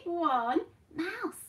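usual speaking voice

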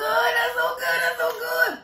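squealing with delight; high, loud, thrilled. Real, not acted. Savoring a drink.

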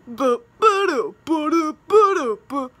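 sung boo doo footstep noises; mock footstep sounds. Kid narrating her own footsteps with silly boo doo sounds. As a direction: bounce out walking sounds like a cartoon. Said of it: "those were the sounds of walking".

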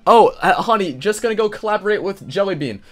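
high, hurried, casual husband act; husband to wife. Acted. Husband telling wife about a collaboration, breezy put-on voice. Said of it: "what is this guy's wife think".

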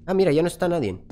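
normal talking voice

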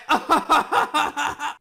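finally cracks up; burst of laughter. Real, not acted. Laughs after holding it in.

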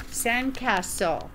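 plain reading voice

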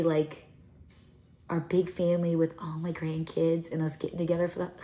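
ordinary delivery

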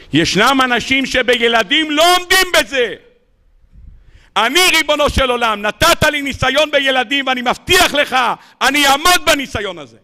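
high, intense vow to God; fervent prayer. Acted. Grieving rebbe vowing to God at his son's funeral, fervent. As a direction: swear to withstand the test. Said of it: "אמר אז הרבי מספינקה".

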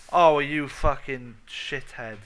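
loud, slow furious curse; angry outburst. Real, not acted. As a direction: yell it at the thing that just wrecked you. Creeper blew him up.

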